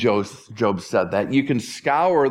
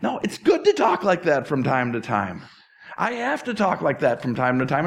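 ordinary delivery

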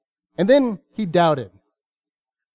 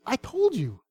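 mild exasperation; 5 semitones higher, 5 dB quieter than usual. Retold or imitated. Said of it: "And Jesus says".

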